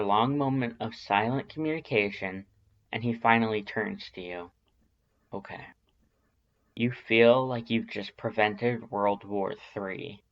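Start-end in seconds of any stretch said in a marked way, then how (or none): 5.3-5.7 very soft, barely voiced okay; quiet concession. A narrator's reading. Sans finally agrees with the reader, soft. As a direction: give in under the breath.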